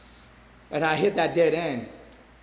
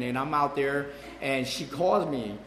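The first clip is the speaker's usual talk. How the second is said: usual speaking voice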